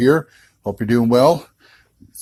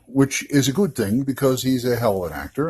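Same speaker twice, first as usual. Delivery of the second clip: ordinary talk, nothing marked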